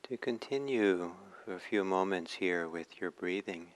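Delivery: ordinary delivery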